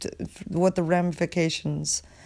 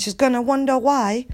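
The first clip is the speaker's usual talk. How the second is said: higher-pitched, badly faked British; bad British accent; 7 semitones higher, 4 dB louder than usual. Podcaster imitating a lady they talk shit about, bad British accent. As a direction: put on a clumsy mock-British accent. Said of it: "so sorry for the bad accent".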